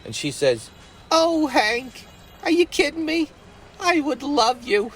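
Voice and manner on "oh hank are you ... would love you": put-on much higher, giddy girl, slowing at the slip; thrilled Betsy voice. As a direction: gush with delight, high and breathless. Betsy thrilled by Hank's offer.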